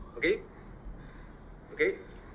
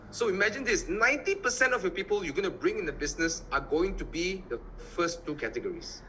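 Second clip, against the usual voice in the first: ordinary delivery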